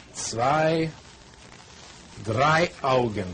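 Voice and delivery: slow, clear German with long pauses between words; slow teacher German. Shown as a demonstration. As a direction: teach German word by word, as to a beginner, pointing at things. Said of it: "Here's lesson number two".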